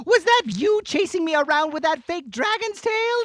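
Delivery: ordinary talk, nothing marked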